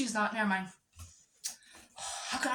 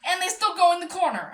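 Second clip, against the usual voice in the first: exasperated rant; 5 semitones higher, 7 dB louder than usual. Real, not acted. Exasperated rant about ferrets.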